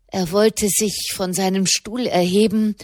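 plain reading voice

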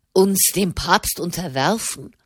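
usual speaking voice

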